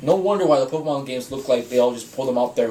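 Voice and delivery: ordinary talk, nothing marked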